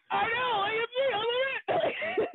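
very high, squeaky, near-tearful delight; giddy squeal. Retold or imitated. Wrestler answering the lady who recognized her, squeaky giddy delight. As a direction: gush like a starstruck kid.

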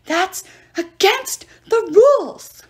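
high, loud, slow protest; outraged cry. A narrator's reading. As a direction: cry foul, stretching every word. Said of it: "Terminus cried".

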